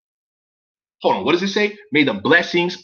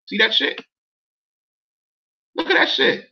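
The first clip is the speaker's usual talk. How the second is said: amazed; 9 semitones higher than usual. Real, not acted. Spotting the asterisk.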